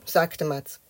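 ordinary delivery

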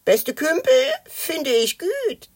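high, drawn-out dragon voice with twisted vowels; Spekulatius. A narrator's reading. Dragon ignores Matz's reproach, high slow creature voice. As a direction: play an odd Christmas dragon, high and slow. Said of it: "sagte Spekulatius".

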